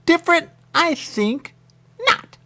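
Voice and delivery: loud squeaky snooty retort; mock haughty reply. Replaying his snooty retort, loud and squeaky.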